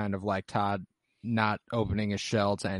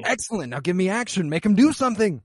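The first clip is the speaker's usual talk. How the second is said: high-pitched teacher egging a student on; excited coaching; 7 semitones higher, 5 dB louder than usual. Retold or imitated. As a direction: urge them on, pitch climbing.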